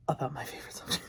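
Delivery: quick breathy whisper with a giggle; laughing whisper. Real, not acted. Repeats phrase, whispered with laugh.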